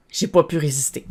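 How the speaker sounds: usual speaking voice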